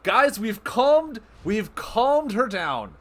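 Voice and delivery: high, loud and slow, stretching out calmed; triumphant cheer to the chat; excited shout. Real, not acted. Streamer cheering to chat after calming the boss, excited shout.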